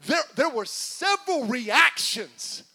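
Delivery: ordinary talk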